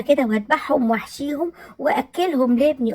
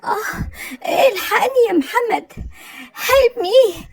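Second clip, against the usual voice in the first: hammed-up pain cries; 7 semitones higher than usual. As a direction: overact a stomach agony, calling for help. Stomach pains, crying for help.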